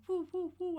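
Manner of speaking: high hooting woo woo woo; mock whistling woo. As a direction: imitate a whistle with your voice. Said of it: "a titty in the wind going".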